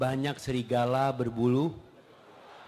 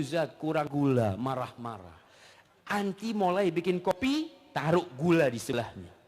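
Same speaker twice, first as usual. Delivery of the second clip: usual speaking voice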